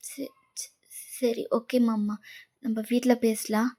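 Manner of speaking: very soft, stammered agreement; meek acceptance. Acted. Girl letting him end the phone call, meek acceptance. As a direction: give in quietly, starting almost in a whisper.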